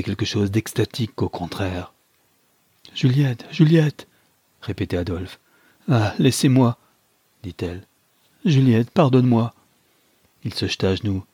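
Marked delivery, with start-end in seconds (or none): 2.9-4.1 calling her, rising higher and louder; acted anguished call; frantic calling. A narrator's reading. Frantic husband calls wounded wife. 5.9-6.8 pained rebuff. A narrator's reading. Wounded wife pushes husband away. 8.5-9.5 loud plea for forgiveness; acted desperate appeal; anguished pleading. A narrator's reading. Husband begs dying wife's forgiveness.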